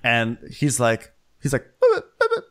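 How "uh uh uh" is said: very high stammer, hammed up; flustered knight. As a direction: sputter like a mock-stunned suitor.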